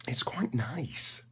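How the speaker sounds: slow hushed whisper; whispered slow aside. Real, not acted. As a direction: breathe it out like a secret. Soft aside about British feed.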